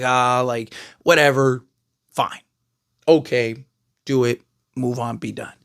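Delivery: dismissive shrug. Acted. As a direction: shrug it off in a dropped, low voice. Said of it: "everyone's just like".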